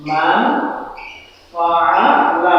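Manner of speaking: "মান ফাআলা" slow, the ন hidden in a nasal hum; nasal ghunna recitation. Shown as a demonstration. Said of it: "তাহলে উচ্চারণ হবে".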